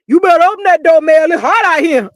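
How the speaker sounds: loud, high call at the door; bang-on-the-door holler; calling through the door. Retold or imitated. Martell outside shouting at Mel to open up.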